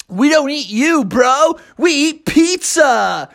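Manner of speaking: high, swooping dude voice; surfer-dude turtle. Acted. Turtles set the frightened frog straight, laid-back dude. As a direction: stretch it out like a cartoon surfer.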